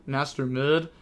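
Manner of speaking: usual speaking voice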